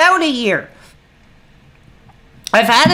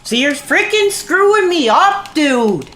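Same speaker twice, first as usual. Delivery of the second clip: higher, exasperated complaint to chat; annoyed outburst; 6 semitones higher than usual. Real, not acted. Streamer scolding chat for distracting him, exasperated. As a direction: snap at chat in real frustration.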